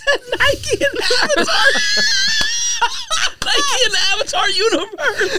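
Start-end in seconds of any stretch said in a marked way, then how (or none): 1.6-3.4 high shrieking laugh. Real, not acted. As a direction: let a big laugh fly, way up high. Cracking up over Nike Avatar.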